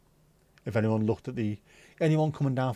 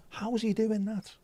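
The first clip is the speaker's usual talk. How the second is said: high-pitched mock astonishment; put-on high voice of disbelief; amazed onlooker; 9 semitones higher, 4 dB quieter than usual. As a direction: voice a baffled bystander, pitched well up. Onlooker amazed at his Dutch.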